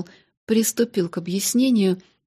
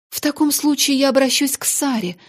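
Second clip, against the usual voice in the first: exclaiming; 6 semitones higher, 4 dB louder than usual. Acted. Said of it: "воскликнула мисс Минчин".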